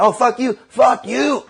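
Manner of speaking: high mock shout; escalating high-pitched acted yell; shouted retort. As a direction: yell back in an acted quarrel. Acted shouting match with driver.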